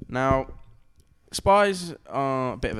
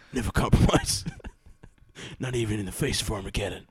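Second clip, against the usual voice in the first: put-on doomed vigilante voice; mock-grave comic-book defiance; Rorschach. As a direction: refuse to back down like a masked avenger. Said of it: "like he does at the end of the film".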